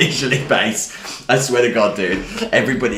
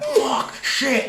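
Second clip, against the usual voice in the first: mild mock yell. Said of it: "So now I'm sc".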